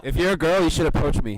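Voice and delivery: announcing loudly to listeners; loud call-out. Real, not acted. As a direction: shout it out to the audience. Calling out to girl listeners.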